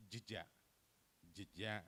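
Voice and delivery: jeja with the e swallowed, j'ja; reduced vowel. Shown as a demonstration. Presenter demonstrates Indonesian epepet, reduced e. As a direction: say Jeja with barely any first vowel. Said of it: "J is not pronounced".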